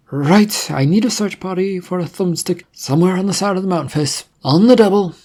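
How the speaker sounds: rally the guards, urgent and firm; commanding agent. Acted. Agent ordering his guards, brisk command.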